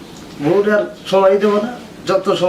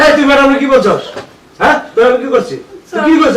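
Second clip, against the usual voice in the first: loud, high-pitched indignation; angry denial; 6 semitones higher, 5 dB louder than usual. Acted. Husband challenging his accusers, loud angry denial. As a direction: throw the accusation back loudly.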